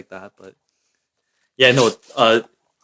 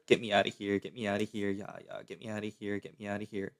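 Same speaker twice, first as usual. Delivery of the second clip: soft, urgent muttering; 17 dB quieter than usual. Real, not acted. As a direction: mutter it under your breath. Fleeing in game, muttered.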